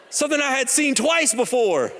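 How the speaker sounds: usual speaking voice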